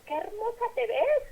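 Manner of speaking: high, gushing praise; admiring compliment. Retold or imitated. A friend gushing a compliment at the woman, high and admiring. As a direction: gush over how pretty she looks.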